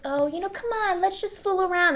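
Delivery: high-pitched coaxing boy imitation; sweet boy. Trope sweet boys coaxing a girl, high playful mimicry. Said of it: "they're sweet boys".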